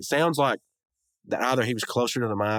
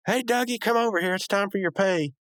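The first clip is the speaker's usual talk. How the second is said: squeaky high-pitched Rigby calling out; sing-song higher voice calling Dougie over; 11 semitones higher than usual. Retold or imitated.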